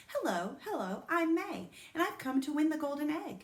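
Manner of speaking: bouncy, self-important greeting; bossy little girl. A narrator's reading. May introducing herself to the family, chirpy.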